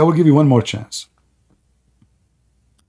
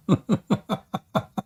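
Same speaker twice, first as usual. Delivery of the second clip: high laugh; 5 semitones higher, 8 dB quieter than usual. Real, not acted. Laughing at own joke.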